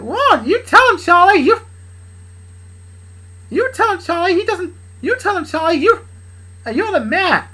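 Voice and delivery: very high, loud excited cheer; cheering sidekick. Acted. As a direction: hype him up in a squeaky voice.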